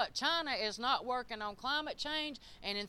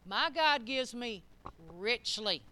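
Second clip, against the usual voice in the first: ordinary talk, nothing marked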